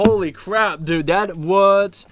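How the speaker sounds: ordinary talk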